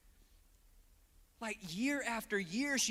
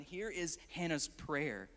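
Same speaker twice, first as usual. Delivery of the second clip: ordinary talk, nothing marked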